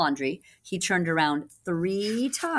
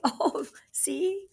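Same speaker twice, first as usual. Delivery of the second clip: whisper; 11 semitones higher than usual. Real, not acted. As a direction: breathe it like a secret. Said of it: "whispered aside to children".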